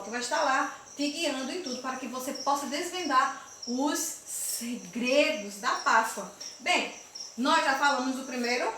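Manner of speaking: ordinary talk, nothing marked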